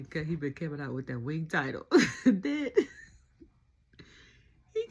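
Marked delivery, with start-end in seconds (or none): 2.2-2.7 a loud, high laugh breaking in. Real, not acted. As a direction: burst out laughing. Laughing at KO's shirt.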